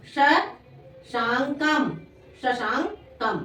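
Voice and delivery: शशांकम very slowly, in separated syllables. Shown as a demonstration. Teacher dictating Sanskrit to pupils, शशांकम slowly broken up. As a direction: sound out शशांकम piece by piece, with gaps.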